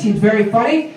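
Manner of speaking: ordinary delivery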